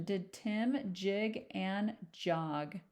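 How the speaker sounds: slow, with "Did Tim jig and jog?" rising at the end; rising question tune. Shown as a demonstration. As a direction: draw it out and lift "jog" up like a question. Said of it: "So that's a question".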